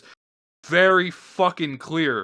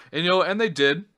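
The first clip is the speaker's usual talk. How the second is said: normal talking voice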